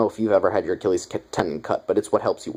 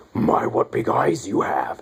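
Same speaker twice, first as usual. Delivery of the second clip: low, slow, husky half-voiced taunt; menacing werewolf quote; 6 semitones lower, slower than usual. Werewolf looking down at her victim, low slow menace. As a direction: draw it out low, toying with prey.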